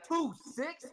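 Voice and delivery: high-pitched imitated crowd chant starter; club call-out. Somebody in a North Carolina club starting the chant, calling out.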